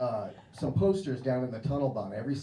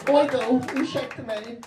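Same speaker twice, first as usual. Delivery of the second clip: mock embarrassed; 10 semitones higher, 6 dB louder than usual. Mock embarrassed apology after bit.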